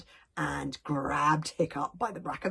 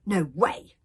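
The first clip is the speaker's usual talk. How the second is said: firm, louder refusal; stubborn; 8 dB louder than usual. Acted. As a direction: dig your heels in. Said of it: "said Hiccup stubbornly".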